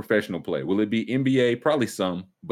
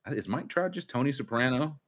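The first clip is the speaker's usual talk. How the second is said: ordinary delivery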